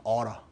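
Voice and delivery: "어러" said as "aw-ruh", the t's flapped into r sounds; ought to reduced to "어러". Shown as a demonstration. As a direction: say "어러" quickly with a soft r in the middle. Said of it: "어러라고 발음하죠".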